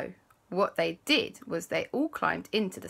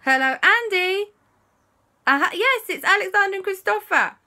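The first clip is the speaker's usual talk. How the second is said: high, loud, eager phone call; excited kids on phone; 11 semitones higher, 6 dB louder than usual. Acted. Children phone the museum man, high and eager. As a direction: chatter up at him like an impatient kid. Kids phoning Andy, voiced.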